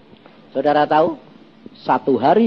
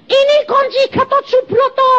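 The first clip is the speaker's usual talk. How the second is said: high, excited woman's voice; wife's voice; 4 dB louder than usual. Retold or imitated. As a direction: speak as the startled wife, pitched up. Wife reports God's message. (suara istri yang tinggi dan bersemangat)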